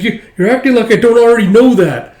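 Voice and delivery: loud, high snapping back; girl yelling back at Alma; angry retort. Acted. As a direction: shout it right back.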